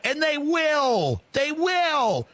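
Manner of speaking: they will, slow, high and pressing; emphatic insistence. Acted. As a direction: hammer it home, drawn out and insistent. Mock-insistent rant.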